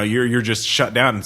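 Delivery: ordinary talk, nothing marked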